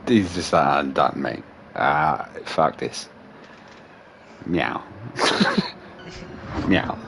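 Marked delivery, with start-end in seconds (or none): 0.7-3.1 low, soft, given-up cat voice; too lazy to talk. Retold or imitated. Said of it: "Too lazy to talk". 4.5-7.0 soft, low, half-hearted meow; lazy meow. Retold or imitated. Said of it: "Too lazy to talk".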